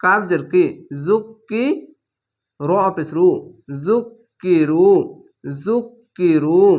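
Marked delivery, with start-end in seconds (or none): 3.9-6.6 জুকিরু slow, with the long u held; drawn-out recitation. Shown as a demonstration. Teacher reading the word to students, drawn out. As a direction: stretch the ending of জুকিরু. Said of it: "এক আলিফ টেনে পড়বেন".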